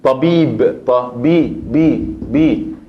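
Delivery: Tabib broken into Ta, bi, bi, bi, slowly; syllable by syllable. Shown as a demonstration. Teacher breaks down Tabib for students, slowly. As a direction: spell Tabib out in separate syllables. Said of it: "Y esa con fatha".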